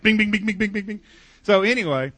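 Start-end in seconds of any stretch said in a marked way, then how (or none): 0.0-1.0 mock bing sounds. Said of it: "They're like".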